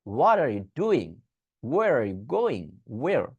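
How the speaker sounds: very slow, drawn-out wh questions; slow demo. Shown as a demonstration. Teacher modeling full wh questions slowly. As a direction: spell out each word slowly. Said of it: "তাহলে what, when, where".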